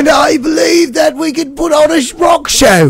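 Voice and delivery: put-on gentleman voice. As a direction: play the pompous newcomer in town.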